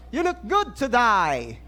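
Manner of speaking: slow, put-on British accent. Shown as a demonstration. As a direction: demonstrate a drawn-out British accent greeting. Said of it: "babatiin mo naman siya British accent". (Britanikong punto)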